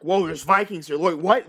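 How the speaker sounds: panicked, loud disbelief; scared townsfolk. Acted. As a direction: blurt it out in alarm.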